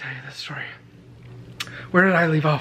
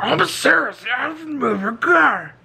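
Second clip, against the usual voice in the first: serious janitor; 9 semitones higher, slower than usual. Retold or imitated. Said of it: "he didn't think that that was very funny".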